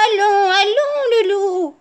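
high-pitched granny voice; high, coaxing grandmother; consoling grandmother. A narrator's reading. Grandmother soothes the sobbing wolf.

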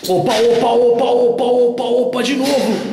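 excited shouting. Real, not acted. Hearing noises, thrilled and scared.

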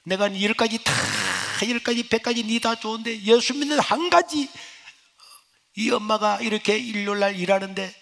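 high-pitched reproach from a mother; angry mother scolding. Retold or imitated. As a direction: pour out a mother's bitter complaint. Mother scolding son, beating chest. (높은 목소리로 꾸짖는 어머니)

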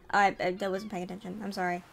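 normal talking voice

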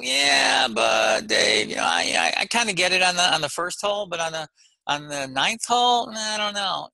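hammed-up pleading excuse voice; excuse-making golfer. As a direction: imitate a golfer with yeah-but excuses. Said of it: "You can't say".

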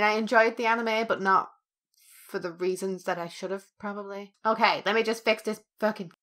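ordinary delivery

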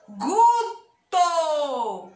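"Guto" loud and stretched in two parts; slow syllable demo. Shown as a demonstration. Teacher giving children a gu word, loud and drawn out.

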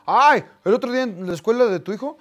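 mock fan chatter; imitating a fan. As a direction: play the excited fan who spotted him. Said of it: "alguien que me dijo".

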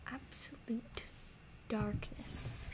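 hushed whisper; whispered dread. Real, not acted. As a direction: whisper into the dark. Hushed in the dark.

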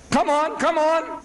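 high, slow goading; mock-impatient demand, pitched high; taunting. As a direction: press him, voice up high. Reverend pressing for an answer.

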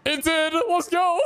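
very high, jubilant squeal; elated cheer. Real, not acted. Player celebrating that it worked, elated cheer. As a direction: cheer way up high, breaking into laughter.

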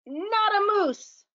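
high call across the castle. Acted. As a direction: call the reindeer from afar. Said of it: "called out to her reindeer".

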